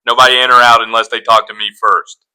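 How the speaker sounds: loud take-charge cartoon voice; forceful impression of a man taking command; Joe. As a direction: bark out orders, loud and commanding. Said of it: "my Joe voice".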